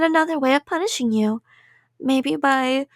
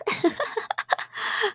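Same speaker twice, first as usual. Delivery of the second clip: creepy giggle; 8 semitones higher, 6 dB quieter than usual. Acted. Yandere giggle after whispering.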